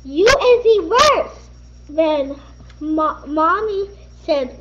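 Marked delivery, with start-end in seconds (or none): 0.0-1.4 put-on high, loud childish yell; child yelling at her mom, high and loud; angry yell. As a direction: scream it at mom. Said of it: "She yelled at her mom".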